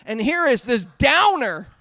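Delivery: ordinary talk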